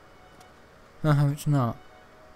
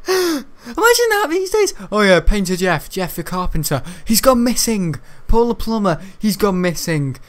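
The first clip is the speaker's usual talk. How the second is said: mocking; 6 semitones higher, 5 dB louder than usual. Mocking the quest cliché.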